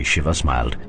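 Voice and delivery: usual speaking voice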